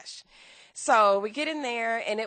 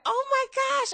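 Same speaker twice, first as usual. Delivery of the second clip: high-pitched retold "oh my gosh" thrill; excited storytelling re-enactment; retold excitement; 4 dB louder than usual. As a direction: replay a thrilled gasp of recognition, pitched way up. Thrilled reaction to being greeted.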